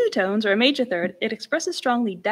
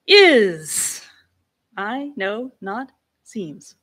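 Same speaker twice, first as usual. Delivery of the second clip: "is" loud and drawn out, "I know not seems" slow with wide slides; Victorian elocution slides; 6 dB louder, much slower than usual. Shown as a demonstration. Lecturer reading Hamlet from Weaver's notation: slow sliding delivery. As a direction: read it like a nineteenth century actor, sliding on each word. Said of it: "bigger slide".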